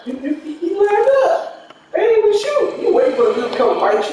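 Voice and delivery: high, laughing, mocking squeal; laughing mockery. Real, not acted. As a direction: laugh at him while you tease. Laughing while mocking friend.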